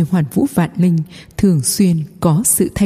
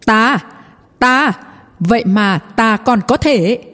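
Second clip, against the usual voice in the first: excited shout; 4 semitones higher, slower than usual. A narrator's reading. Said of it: "phấn khích hét to một tiếng".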